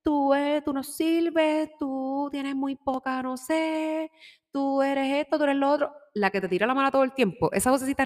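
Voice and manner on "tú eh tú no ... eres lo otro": higher nagging inner voice putting you down; doubting ego voice. Acted. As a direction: voice the ego that says you can't. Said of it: "esa vocecita del ego es la voz resistente". (voz del ego, aguda y despectiva)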